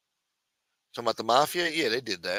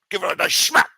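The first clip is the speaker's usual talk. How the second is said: higher, breathy-free unvoiced Scottish Connery rasp on nice smack; Sean Connery accent; 8 semitones higher, 5 dB louder than usual. [name] repeating advice, husky Scottish Connery accent. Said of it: "What do you think he sounded like".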